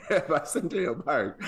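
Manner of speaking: high, loud words breaking into laughter; helpless laugh pushing the voice up; laughing through words. Real, not acted. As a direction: laugh your way through the line. Laughing over own story.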